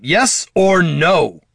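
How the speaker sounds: loud, high, drawn-out demand; snapped, stretched-out ultimatum; impatient snap. A narrator's reading. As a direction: cut in, demanding an answer. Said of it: "snapped Jin".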